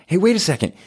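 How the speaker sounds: excited discovery. Retold or imitated. Host acts out disciples spotting the prophecy, high excited discovery. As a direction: jump up in pitch like it just clicked.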